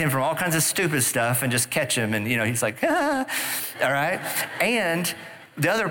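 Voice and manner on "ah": much higher exclaim, imitating the son; a squeaky kid's "Ah" tossed off; mock carefree child's exclaim. Son's carefree reaction, mimicked.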